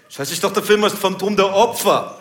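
mock wannabe-gangster indignation; gangster customer. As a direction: protest like an aggrieved street tough. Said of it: "so ein Gangsterpärchen".

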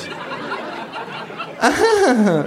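long, high fake creature laugh; kangaroo's mimicking laugh. A narrator's reading. Kangaroo echoing hostess, long artificial laugh. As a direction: laugh along falsely, drawing it out. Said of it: "Lacht auch das Känguru".

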